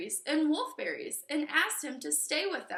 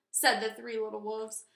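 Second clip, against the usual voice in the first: ordinary delivery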